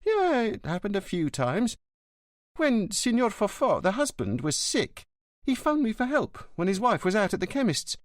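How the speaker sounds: lightly voiced concierge; old concierge. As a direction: answer like a gossipy porter.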